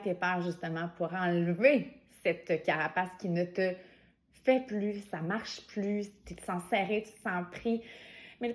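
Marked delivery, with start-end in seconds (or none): none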